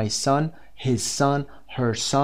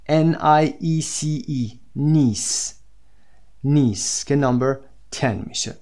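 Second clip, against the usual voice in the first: ordinary delivery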